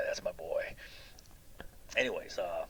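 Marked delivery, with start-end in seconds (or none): 0.1-0.6 hushed, proud whisper to himself; secret inner pride. Retold or imitated. Uncle whispering proudly about his headbutting nephew. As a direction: whisper it like a private boast. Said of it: "But inside I was saying".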